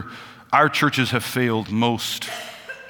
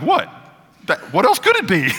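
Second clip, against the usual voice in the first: mock indignant retort; 8 semitones higher, 3 dB louder than usual. Child defends his answer when laughed at.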